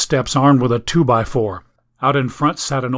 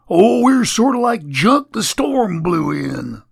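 grinning drawl; 8 semitones higher, 3 dB louder, slower than usual. Acted. Staples answers with a grin.

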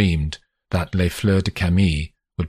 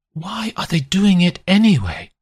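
higher, sharp accusing wife's voice; 13 semitones higher, 3 dB louder than usual. A narrator's reading. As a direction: voice a betrayed mother, pitched up and pointed. Wife voiced.

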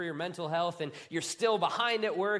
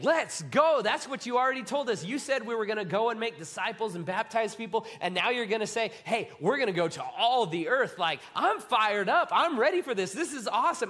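higher and louder, laughing with excitement; pumped-up disciple; 5 semitones higher, 5 dB louder than usual. Retold or imitated. Disciple fired up by the mission, loud and excited. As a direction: shout it like a fan before the game. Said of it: "And you're like".

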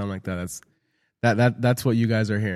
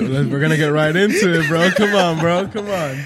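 higher and louder, egging a friend on to talk; excited urging; 4 semitones higher, 3 dB louder than usual. Real, not acted. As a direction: come on, spill it, bro, playful push. Pushing Lenny to spill the news.

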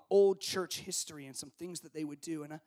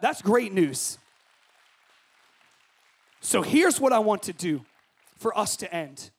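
ordinary talk, nothing marked

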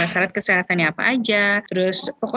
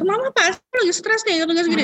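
put-on much higher, playful kid voice; mock child voice; 11 semitones higher than usual. Child guessing mom is stressed, high playful voice.

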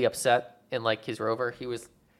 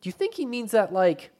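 incredulous NASA director; 10 semitones higher than usual. Retold or imitated. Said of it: "it just cuts to the nasa director".

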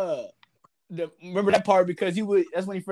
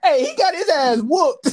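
laughing; 9 semitones higher, 6 dB louder, slower than usual. Real, not acted.

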